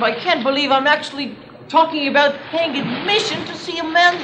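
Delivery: exasperated disbelief. Acted. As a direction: pitch climbing in outraged amazement. Gentleman exasperated by the offer.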